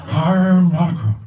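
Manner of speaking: a light take on the disgraced president; hint of a Nixon voice; Nixon impression. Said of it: "he's Nixon".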